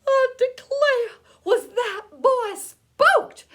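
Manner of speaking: high, loud storyteller's outburst; astonished exclamation. A narrator's reading. Narrator reacting to the spooked boy, astonished. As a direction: burst out in amazement.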